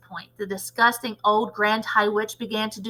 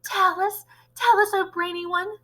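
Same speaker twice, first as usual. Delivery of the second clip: high-pitched pleading followers; eager begging; 8 semitones higher than usual. A narrator's reading. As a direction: beg the great leader in a high voice. Witch audience begs its leader.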